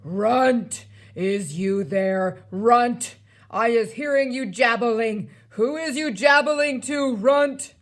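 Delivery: booming, loud giant bellowing for someone; big thundering giant voice; thunderous giant shout. A narrator's reading. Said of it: "a voice like thunder shouted".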